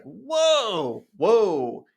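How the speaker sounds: high, drawn-out whoa; a hint of startled disbelief; mock surprise. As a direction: act caught off guard. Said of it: "I was like".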